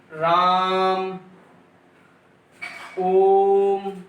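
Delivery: राम stretched very slowly like calling someone; calling a name, vowel held long; drawn-out call. Shown as a demonstration. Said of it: "किसी को बुलाना".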